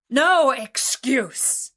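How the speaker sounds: loud, high, drawn-out refusal; stern beast outburst. A narrator's reading. Beast refusing the merchant any excuse, angry roar. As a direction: roar it slowly as an implacable beast.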